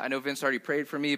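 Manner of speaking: ordinary talk, nothing marked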